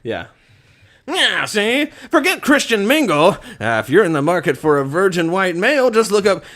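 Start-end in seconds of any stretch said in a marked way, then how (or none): 1.1-6.4 put-on high, punchy old-time gangster voice; traditional gangster. Shown as a demonstration. Player reading a mock dating ad, high gangster voice. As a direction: talk like an old movie gangster, pitched up. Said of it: "we're gonna go traditional gangster".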